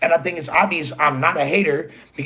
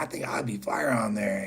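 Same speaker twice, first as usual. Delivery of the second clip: hushed, breathy retold line; retold whisper; 4 semitones lower, 7 dB quieter than usual. Telling Sharp how he asked to join, whispered. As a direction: whisper it like a shy confession. Retelling his own pitch to join.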